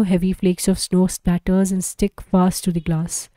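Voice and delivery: ordinary delivery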